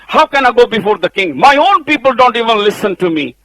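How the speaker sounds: high, loud complaining Moses; Moses pleading. Acted. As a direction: plead your excuse to God. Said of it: "He says".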